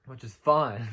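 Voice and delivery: loud, dry "fine" that means not fine; sarcastic concession; grudging sarcasm. Real, not acted. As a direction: say it's fine when it clearly isn't. Grudging verdict before a laugh.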